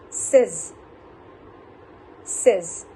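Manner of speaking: says as 'sez', falling, each one set apart; correct says. Shown as a demonstration. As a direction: repeat says as a model, with a short e. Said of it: "how to pronounce that word says".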